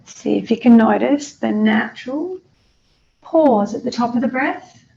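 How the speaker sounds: unhurried meditation guide with long pauses; guided meditation. Acted.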